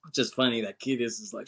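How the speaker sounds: normal talking voice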